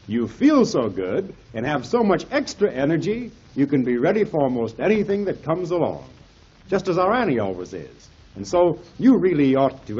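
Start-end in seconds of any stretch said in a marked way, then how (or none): none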